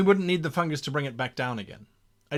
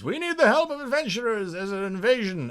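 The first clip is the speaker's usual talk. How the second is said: desperate refugee; 7 semitones higher than usual. Acted. Refugee voiced pleading for help.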